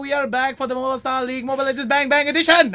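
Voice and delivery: high, fast, breathless announcer intro; all in one breath. Acted. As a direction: rattle it off without a breath. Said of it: "I did that all in one breath".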